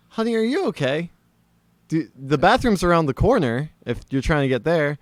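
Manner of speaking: imitated mom, pitched well up; high, careful questioning of a guest; concerned mother. Mother gently checks on drunk houseguest, concerned.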